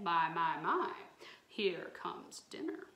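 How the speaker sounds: gloating swamp monster, loud then sinking low; hungry swamp creature licking its lips; greedy monster. Acted. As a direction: relish the word dinner like a hungry beast. Gobbledygook greets his prey.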